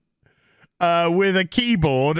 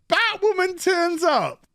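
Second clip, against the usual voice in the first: "Batwoman" said much higher, like a big reveal; a much higher retake of "Batwoman turns up"; dramatic announcement; 10 semitones higher than usual. Shown as a demonstration. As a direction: announce her arrival like a big entrance.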